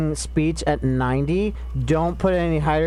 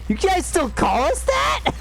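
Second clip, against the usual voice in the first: much higher, slow mock disbelief; high incredulous squeak; slower than usual. Retelling a Discord chat to a friend, high mock disbelief. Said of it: "and I'm like".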